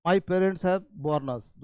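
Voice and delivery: my parents have born us, same words and same sound, slow and clear; slow teaching read again. Shown as a demonstration. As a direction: repeat it slowly, same pronunciation. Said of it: "उच्चारण same है". (धीमा दोहराव, वही उच्चारण)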